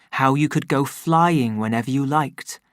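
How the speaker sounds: ordinary delivery, nothing marked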